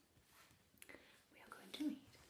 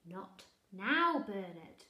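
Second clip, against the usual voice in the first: louder weary brush-off; dismissive mother; 14 dB louder, much slower than usual. Acted. Mother dismissing Bernard again, weary.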